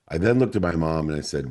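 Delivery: usual speaking voice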